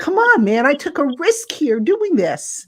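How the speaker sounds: high-pitched mock complaint; teasing, busting on him. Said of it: "I was busting on him".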